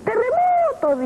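put-on high startled shriek of a scared old lady; frightened cry. Scared old woman crying out at the shaking, high frightened shriek. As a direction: yelp it in sudden terror, high.

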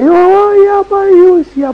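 put-on high, loud frightened little boy; scared child voice. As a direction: cry out like a terrified child. Grandson afraid of the goat.

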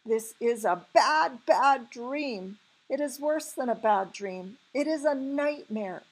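alarmed cry. A narrator's reading. BFG warning Sophie of the nightmare, alarmed cry. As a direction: cry it out, leaning on BAD. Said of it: "he cried".

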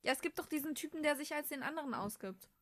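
normal talking voice

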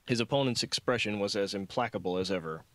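usual speaking voice

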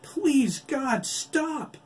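very high, loud desperate scream; terrified scream for mercy. Acted. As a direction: shriek to stop the attack. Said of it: "Oliver screamed".

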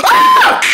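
very high, loud shriek; scream of fright. Acted. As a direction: shriek as he's startled. Gets jump scared.

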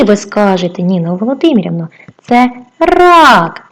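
ordinary delivery

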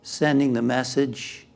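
measured, calming delivery that settles at phrase ends; guided meditation. Acted. Leads a guided relaxation.

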